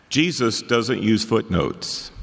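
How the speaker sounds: normal talking voice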